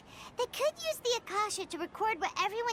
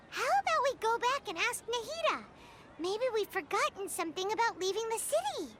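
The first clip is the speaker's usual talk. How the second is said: normal talking voice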